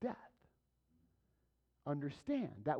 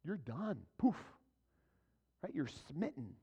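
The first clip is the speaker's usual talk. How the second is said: ordinary talk, nothing marked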